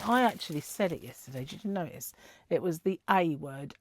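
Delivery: ordinary delivery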